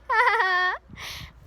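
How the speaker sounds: high gleeful cackle; mocking cackle. A narrator's reading. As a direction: cackle at the fooled caretaker. Peeves cackling.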